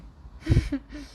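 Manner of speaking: a bright, ringing, high friendly laugh. Acted. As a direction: laugh warmly at the silly boy. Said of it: "Ela riu de novo, em um som amigável e vibrante". (risada amigável e vibrante)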